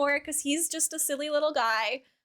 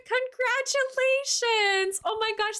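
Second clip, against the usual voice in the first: excited congratulations; 8 semitones higher, slower than usual. Real, not acted. Reacting to viewer's wedding news.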